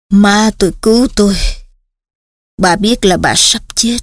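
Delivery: flat, lifeless monotone; emotionless monotone. Acted. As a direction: recite it with no feeling at all. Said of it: "bằng một giọng đều đều, không cảm xúc".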